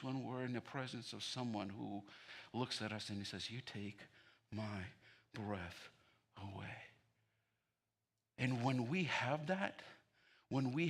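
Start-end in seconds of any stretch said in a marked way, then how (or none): 3.5-6.8 tender words. Acted. The beloved's delighted words.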